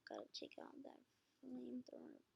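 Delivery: unvoiced whisper to self. Real, not acted. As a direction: whisper it while working. Quietly editing team.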